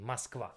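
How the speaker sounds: Moskva with the first o reduced to an a sound; Moskva, unstressed o said as a; Russian Moskva. Shown as a demonstration. Teacher showing how she really says Moskva in Russian. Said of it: "That's how I say actually in Russian".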